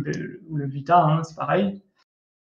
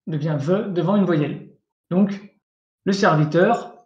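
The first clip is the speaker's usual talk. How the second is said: normal talking voice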